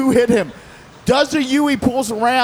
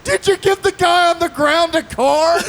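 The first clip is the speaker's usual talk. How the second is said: mock laughing shout; 8 semitones higher than usual. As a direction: yell it like a cop cracking up. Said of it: "He goes".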